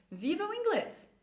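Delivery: high, lively exhortation; full of life. Shown as a demonstration. Teacher urges learners to live English, high and lively. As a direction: urge them with bright energy. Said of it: "Deixa ele ter vida em você".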